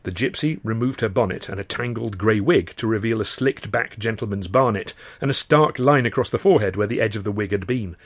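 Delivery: plain reading voice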